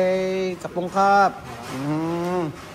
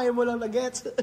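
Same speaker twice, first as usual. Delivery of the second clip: laughing; 7 semitones higher, 4 dB quieter than usual. Real, not acted. Teasing friend for late joke.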